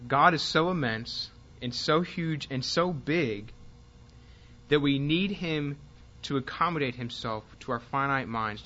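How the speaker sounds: slow, deliberate and weighty phrases; really slow reading. Real, not acted. Teacher reading a point to note-takers, really slowly. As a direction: read it out slowly, pausing between phrases. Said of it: "read through this really slowly".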